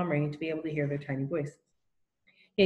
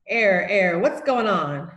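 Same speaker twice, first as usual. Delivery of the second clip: loud stern call; 6 dB louder, much slower than usual. A narrator's reading. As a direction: break up the fight with a loud call. Lunch lady breaking up fight.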